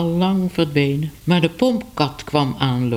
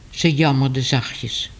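ordinary delivery

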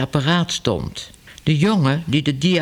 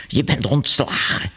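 hissed; 3 semitones lower than usual. A narrator's reading. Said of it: "siste meneer Ellemeet".

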